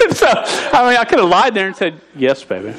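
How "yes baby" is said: low, softer sweet-talk; mock smooth lover. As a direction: pretend to be a suave boyfriend. The smooth lie he could have told.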